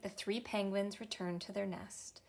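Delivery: usual speaking voice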